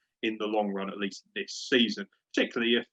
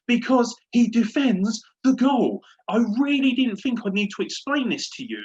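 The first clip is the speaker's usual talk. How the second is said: angry rant; 8 semitones higher than usual. Real, not acted. Lecturing the ex-player pundit, high angry rant. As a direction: berate him, spelling it out.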